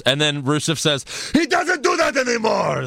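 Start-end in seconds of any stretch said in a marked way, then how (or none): 1.3-2.8 high shouted retort; mock yell. Rusev yelling at the crowd, high mock shout. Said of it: "yells at the crowd".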